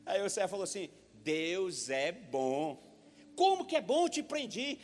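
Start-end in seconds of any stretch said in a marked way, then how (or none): 1.1-2.8 slow servant reply. Retold or imitated. 3.3-4.8 astonished king. Retold or imitated.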